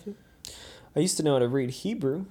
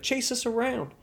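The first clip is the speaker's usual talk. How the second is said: put-on higher-pitched kid plea; child begging to play; 9 semitones higher, 3 dB quieter than usual. Babysitter imitates kids asking to be chased, eager high voice. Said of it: "they'd be like".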